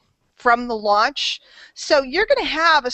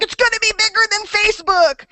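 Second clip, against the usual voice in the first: mock hype, pitched way up; hyped-up imitation; 9 semitones higher than usual. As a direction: imitate an overexcited fan, high-voiced. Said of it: "someone today was like".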